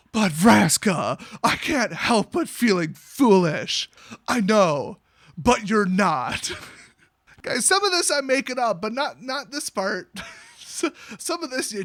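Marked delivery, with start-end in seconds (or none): 0.1-6.5 loud, slow soap-opera delivery; mock melodrama. Jace confesses to Vraska, hammed-up melodrama. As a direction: ham up each line as anguished drama. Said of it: "it's not already too melodramatic".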